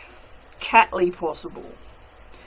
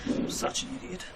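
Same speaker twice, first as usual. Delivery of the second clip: self-scolding whisper; 11 dB quieter than usual. Real, not acted. Scolding herself after mistake.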